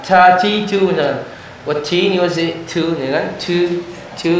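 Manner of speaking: "ca", "ci", "cu" with overdone hiss; exaggerated ca ci cu. Shown as a demonstration. Teacher warning students against hissy "cu". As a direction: demonstrate the wrong, overdone air. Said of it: "tidak boleh berlebihan".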